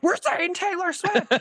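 high, drawn-out excited squeal; squealing excitement. Retold or imitated. Sisters on the phone squealing about [name], high excited squeal. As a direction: shriek it like a thrilled fan. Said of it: "We're like".

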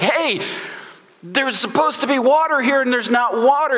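high, indignant panic; king of Israel complaining there is no water, high and upset; freaking out. Retold or imitated. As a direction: pitch it high, a king who feels betrayed. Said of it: "he's kind of freaking out about that".

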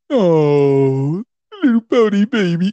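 exaggerated mocking sympathy, sliding up high; mock baby talk. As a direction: coo at him like a pouting baby.

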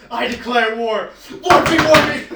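loud, high mock battle cry; mock challenge. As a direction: yell a playful challenge. Mock war challenge between friends.

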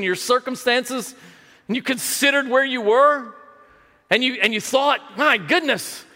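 high-pitched, urgent sermon appeal; impassioned preaching. Acted. Preacher asking congregation to recall their own despair, impassioned preaching. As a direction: press it home with mounting feeling.

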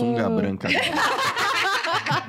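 high, slow, giggling tease; laughing. Real, not acted. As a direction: giggle the words out. Teasing joke, group laughing.

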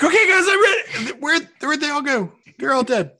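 high, loud, hammed-up eager player; exaggerated high-pitched impression of an oblivious latecomer; imitating Jared. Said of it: "Jared's gonna be like".

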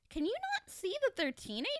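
high-pitched disbelief; can't believe they don't see it; exasperated, high incredulous question. Acted. Incredulous at the applauding audience.

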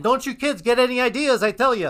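high, loud, mock stern warning; scolding old man. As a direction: wag a finger at the kids.

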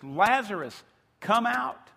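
loud call. Acted. Jesus calls Lazarus from the tomb, loud command. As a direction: call it out with force, pitch climbing on "come out". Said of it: "he calls out in a loud voice".

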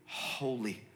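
soft, low, after a pause; softly awed; quiet reverence. Acted. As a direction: let the word drop to a hushed low.

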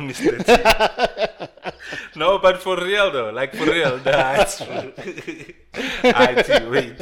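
loud laughing talk. Real, not acted. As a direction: talk through big laughter.

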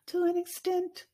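high sing-song. Shown as a demonstration. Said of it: "repeated qualifier".

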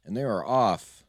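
lively race-calling announcer voice. Acted. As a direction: call the chicken race like live sports. Holds race announcer calls.

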